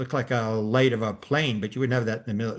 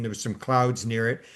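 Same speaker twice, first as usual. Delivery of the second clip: ordinary talk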